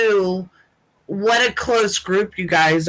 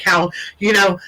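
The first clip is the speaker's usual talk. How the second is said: ordinary talk, nothing marked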